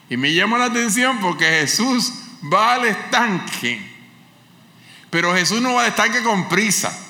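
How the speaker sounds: high, loud pulpit fervour; fervent preaching. Acted. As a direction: lift the church with every phrase. (predicación ferviente, aguda y fuerte)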